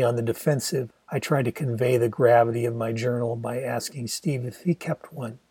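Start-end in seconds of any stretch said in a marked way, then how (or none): none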